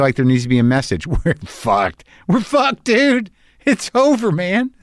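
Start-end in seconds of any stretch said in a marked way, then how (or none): 2.3-4.7 much higher, squeaky mock doom; high mock despair. Real, not acted. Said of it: "repeats line in high mock voice".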